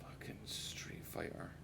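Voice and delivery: very soft, slow whisper; muttered whisper. Real, not acted. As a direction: whisper it slowly under your breath. Muttering to himself about the game.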